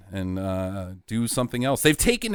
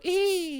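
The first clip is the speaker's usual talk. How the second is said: put-on girly squeal; 4 dB quieter than usual. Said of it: "run away holding your breasts and go".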